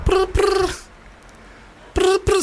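high trilled brr-brr like a ringing phone; phone ring imitation. Host fakes the sex line ringing, high trilled brr. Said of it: "just ringing".

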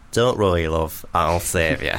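low, reassuring mock hero voice; manly rescuer. Boyfriend reassures girlfriend in a fire, mock-manly low voice. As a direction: play the cool deep-voiced protector.

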